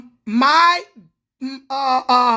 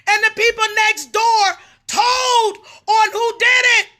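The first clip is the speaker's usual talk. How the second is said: heated rant; 6 semitones higher than usual. Real, not acted. Ranting at preachers.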